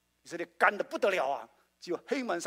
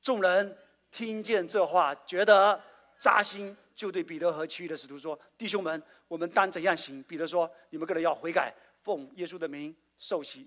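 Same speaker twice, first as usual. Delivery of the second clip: group scripture reading; 6 dB louder than usual. Shown as a demonstration. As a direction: read the verses out with the church. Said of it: "再念这段话".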